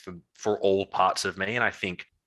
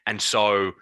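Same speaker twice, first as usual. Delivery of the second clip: ordinary talk, nothing marked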